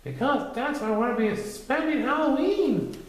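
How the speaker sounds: high small pig voice; timid Piglet. A narrator's reading. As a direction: squeak the plan anxiously.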